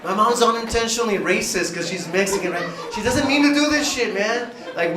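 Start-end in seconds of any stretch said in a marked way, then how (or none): none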